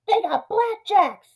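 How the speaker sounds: high, drawn-out mock alarm; panicky Myron. Myron arguing with his conscience about gangsters, high comic panic. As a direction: wail it slowly like a hammed-up coward. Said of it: "Myron's like".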